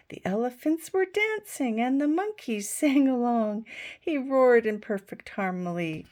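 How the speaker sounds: lively picture-book reading with voices for the animals; reading to children. A narrator's reading. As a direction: read it like a bedtime story for little ones.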